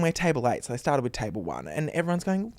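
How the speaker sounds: normal talking voice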